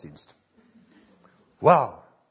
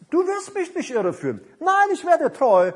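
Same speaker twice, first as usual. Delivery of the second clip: very high, raised cry of refusal; acted defiance; 13 semitones higher, 5 dB louder than usual. Believer resisting the devil, loudly defiant. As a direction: hurl it back at the tempter, high and strong.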